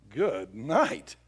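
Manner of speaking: ordinary delivery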